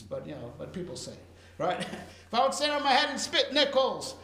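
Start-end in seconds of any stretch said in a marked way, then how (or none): 2.3-4.1 "spit nickels" said higher and louder, with amusement; playful emphatic repeat. Shown as a demonstration. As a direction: replay the saying with a grin. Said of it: "I never understood what that expression means".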